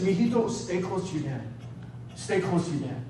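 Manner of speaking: low, hushed motherly advice; soft imitation of a caring mother; tender mom. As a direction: murmur it gently, just between mother and child. Mom quietly advising her son.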